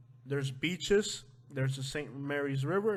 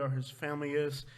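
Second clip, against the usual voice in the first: usual speaking voice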